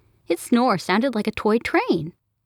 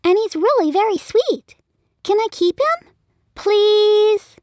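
put-on high, pleading little-girl voice rising to a louder please; a small girl begging her parents, high and louder at the end; begging child; 5 dB louder than usual. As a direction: plead like a kid wanting a pet. Child begging to keep pet.

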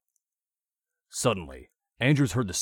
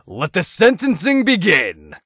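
much higher, louder, slow proclamation; grand announcement; 12 semitones higher, 16 dB louder than usual. A narrator's reading. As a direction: declare it with relish. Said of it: "Andrews announced".

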